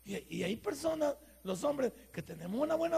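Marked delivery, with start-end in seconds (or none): none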